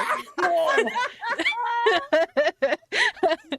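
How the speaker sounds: long, high-pitched fit of laughter; helpless high laughter, going on and on; laughing fit. Real, not acted. Player cracking up at a friend's bogus slang, laughing fit.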